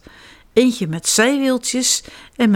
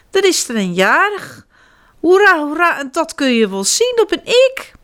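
much higher, louder birthday cheer; excited child bear; 8 semitones higher, 3 dB louder than usual. Acted. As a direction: crow with joy like a small child. Little bear cheers his birthday. (opgewonden kinderstem, hoera)

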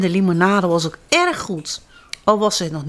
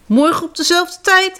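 high, raised call after him; 11 semitones higher, 4 dB louder than usual. A narrator's reading. The Zwifser calling after departing Siertje, high call. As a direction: call across the distance. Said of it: "riep hij hem na".

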